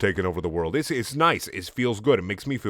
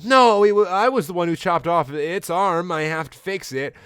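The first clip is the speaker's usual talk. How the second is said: hammed-up high insistence; Pearl protesting; 8 semitones higher, 4 dB louder than usual. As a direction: protest in a pitched-up character voice. Voicing Pearl protesting, high pitch.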